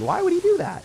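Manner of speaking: high, loud incredulous question; shocked squeal. Real, not acted. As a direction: squeal in disbelief. Shocked at opponent play.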